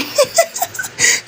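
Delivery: a high, breathless little laugh; teasing laugh. Acted. Wife teasing her husband in front of his mother, a breathy laugh. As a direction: laugh it off, airy and sly.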